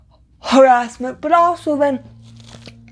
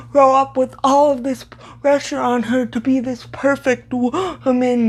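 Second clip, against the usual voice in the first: ordinary talk, nothing marked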